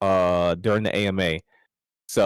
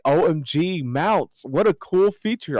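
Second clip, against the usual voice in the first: mock-gushing, higher pitch; joking hype; 6 semitones higher than usual. Player joking about a basic new game feature, mock-excited. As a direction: gush like an easily impressed fan. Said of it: "I remember joking around, like".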